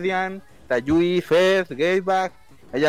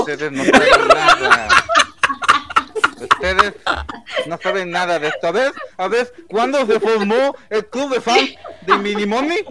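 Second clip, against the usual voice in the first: higher, haughty know-it-all taunting; smug superfan; 5 semitones higher, 5 dB louder than usual. Acted. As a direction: lord it over everyone as the top fan.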